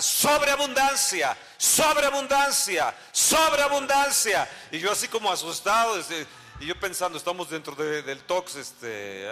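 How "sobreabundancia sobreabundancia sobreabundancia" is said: high, drawn-out cry; reenacted prophetic shout, stretched long and high; imitating her shouting. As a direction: proclaim it slowly, each repeat falling away. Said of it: "Y empezó a gritar".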